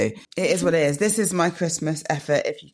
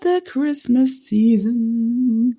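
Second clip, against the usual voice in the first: very slow, higher sing-song delight; drawn-out sing-song; 9 semitones higher, 4 dB louder, slower than usual. Pretend. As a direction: stretch it out, grandly pleased. Continuing hammed answer.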